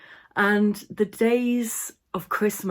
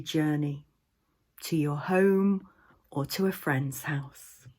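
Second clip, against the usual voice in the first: guided meditation. Acted. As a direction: guide listeners into the imagined scene, unhurried.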